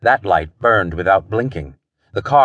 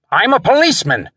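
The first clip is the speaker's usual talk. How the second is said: high, urgent shout; 12 semitones higher, 4 dB louder than usual. A narrator's reading. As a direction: call it out high to a guard. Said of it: "Wentworth shouted".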